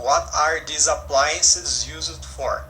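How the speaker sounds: each word of the question read slowly and clearly; slow model reading. Shown as a demonstration. Teacher reads the question slowly and clearly to her students. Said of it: "lembrando a pergunta".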